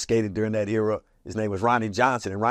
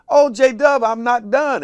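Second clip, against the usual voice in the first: high loud protest imitating her; protesting young rapper; 14 semitones higher, 8 dB louder, slower than usual. Young rapper begging J-Dub to let her finish, high loud protest.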